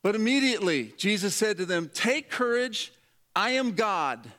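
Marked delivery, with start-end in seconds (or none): 1.9-4.3 higher, slow, each word laid down; slow proclaiming. Retold or imitated. Jesus calling out to frightened disciples, slow and commanding. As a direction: proclaim it slowly, steady and sure.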